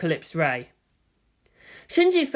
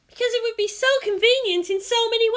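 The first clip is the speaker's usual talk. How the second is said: put-on much higher, breezy girl's voice; flippant Asuka. A narrator's reading. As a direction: toss it off carelessly. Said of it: "Asuka responded flippantly".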